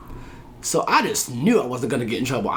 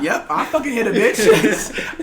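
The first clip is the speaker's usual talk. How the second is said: laughing boast; 8 semitones higher, 5 dB louder, slower than usual. Retold or imitated. Mock proud boast.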